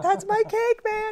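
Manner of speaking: high, loud giddy exclamation; excited. Retold or imitated. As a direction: squeal it like a thrilled fan. Starstruck exclaiming about photo.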